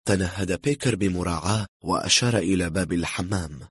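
ordinary delivery

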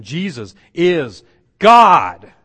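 slow, loud JESUS IS GOD with GOD drawn out; exclamatory emphasis. Retold or imitated. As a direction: proclaim it, landing very loudly on GOD. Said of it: "like putting an exclamation mark on it".

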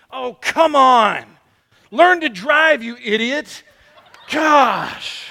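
loud, slow mock outburst at a driver; daughter's road rage. Little daughter yelling at a slow driver from the car seat, mock angry. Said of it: "in just a sweet little voice behind me, well, it wasn't so sweet".

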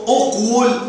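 "أقول" as uq-wul, sounded w; underlying form. Shown as a demonstration. Teacher showing the original imperative, sounded w. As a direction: close on the q in "أقول", then say wul. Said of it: "أصله".

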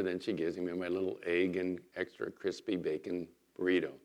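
ordinary delivery

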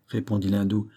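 plain reading voice

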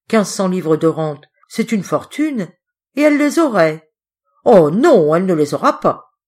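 from bitter muttering to loud outburst; jealous rage building. A narrator's reading. Jealous servant working himself up.

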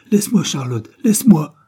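loud, high narrated cry, rising on the repeat. A narrator's reading. Caroline to Charlotte, who holds her back, crying out. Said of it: "cria-t-elle".

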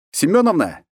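calling out loud across the yard; shouting. Acted. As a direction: shout the order to the cook. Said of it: "крикнул я нашей главной кухарке".

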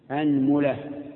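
أنملة with a open, anmula; أنملة with fatha on the hamza and damma on the meem. Shown as a demonstration. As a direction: say أنملة starting with a clear a. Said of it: "مع ضم الميم".

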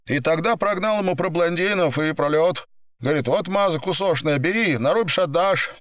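high, urgent pleading voice; pinned gangster, pleading. Acted. Pinned man explains himself to boss, high urgent pleading. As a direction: plead your case in a high voice.

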